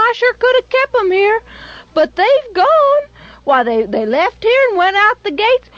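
falsetto innocent woman. Acted. Rahab misleading the soldiers, high pitched innocent helpfulness. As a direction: act the innocent, eager to help.

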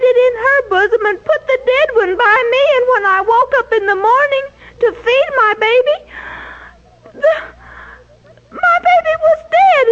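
high, pleading, tearful mother's voice. A narrator's reading. Grieving mother begging King Solomon, tearful and high. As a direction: plead through tears for your baby. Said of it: "in the midst of her tears".

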